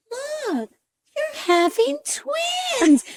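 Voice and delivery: very high, slow sing-out; doctor announcing news. Retold or imitated. Doctor telling patient about twins, high and drawn out.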